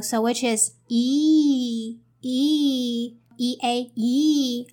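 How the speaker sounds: "E, E A E" with a long drawn-out ee sound; long E vowel. Shown as a demonstration. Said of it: "E A is the long vowel".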